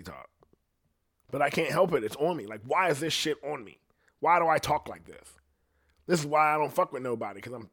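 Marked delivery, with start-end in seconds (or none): none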